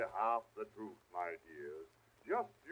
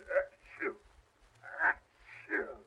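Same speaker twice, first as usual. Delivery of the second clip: big sneezes; slower than usual. Acted. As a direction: sneeze twice with a cold. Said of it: "I've caught quite a bad cold".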